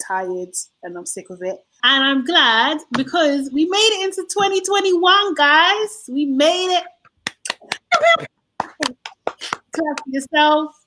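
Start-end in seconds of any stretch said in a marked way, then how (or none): 1.8-10.7 louder and higher, cheering the listeners on; rally everyone like a celebration; excited cheer. Real, not acted. Celebrating making it to new year.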